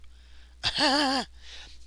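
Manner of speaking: a short high burst of real laughter; bright real laugh from the chest. Real, not acted. Reacting to a chat joke.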